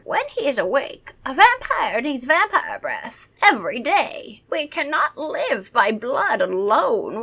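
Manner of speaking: slow, high, pompous vampire; theatrical vampire voice; vampire impression. A narrator's reading. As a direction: lecture slowly as an old vampire. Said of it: "That's my vampire impression".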